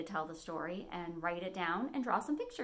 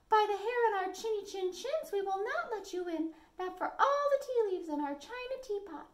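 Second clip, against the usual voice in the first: much higher squeaky wolf-cub voice; defiant little voices; little wolves; 12 semitones higher than usual. A narrator's reading. Wolves refusing the pig again, high and squeaky. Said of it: "said the three little wolves".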